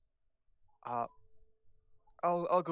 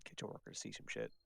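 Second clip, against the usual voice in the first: whispered aside; 13 dB quieter than usual. Real, not acted. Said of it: "aside muttered".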